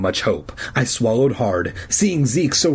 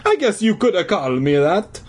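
high cartoon Italian accent; musing old Italian janitor; 7 semitones higher than usual. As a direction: concede it in a hammy Italian accent. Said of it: "in his hoarse Italian voice".

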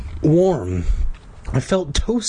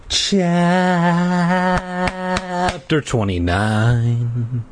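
slow, drawn-out chapter title on held notes; chapter announcement; 4 semitones higher, much slower than usual. A narrator's reading. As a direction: stretch out the title theatrically.

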